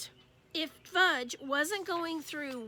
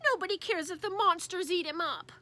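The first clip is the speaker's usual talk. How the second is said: mild crying whine; 5 semitones higher, 4 dB louder than usual. Acted. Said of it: "Fudge started to cry".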